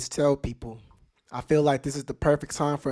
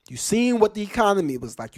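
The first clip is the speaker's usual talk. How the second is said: ordinary delivery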